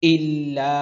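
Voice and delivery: slow melodic chanted recitation; Quran recitation. Shown as a demonstration. Teacher chanting verse slowly for students. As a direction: chant each word drawn out for students to repeat.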